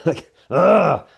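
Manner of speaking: fed up with the slow pace; exasperated groan. Real, not acted.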